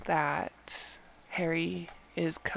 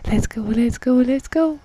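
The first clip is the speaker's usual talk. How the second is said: hyped; 3 semitones higher, 12 dB louder than usual. Real, not acted. Hyped for next item.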